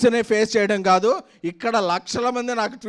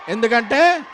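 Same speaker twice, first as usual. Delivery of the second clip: ordinary delivery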